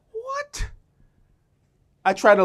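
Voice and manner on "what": squeaky high "What?" shot up in pitch and softer; tiny high incredulous "What?"; mock disbelief. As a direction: feign total bafflement. Mock baffled reaction.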